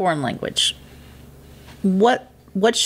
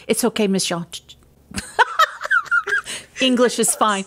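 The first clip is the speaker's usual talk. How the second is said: laughing through the imitated quote; amused pupil imitation; 5 semitones higher than usual. Teacher retelling pupils' teasing about her Spanish, laughing. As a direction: play the kids letting teacher off, through laughter.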